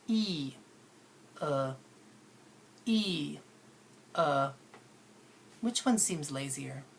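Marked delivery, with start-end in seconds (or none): 0.1-4.5 "E" spread, then "uh" relaxed, alternating; vowel contrast demo. Shown as a demonstration. Teacher drilling two vowels for students, alternating "E" and "uh". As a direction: contrast "E" against the lazy "uh". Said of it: "Let's try another one".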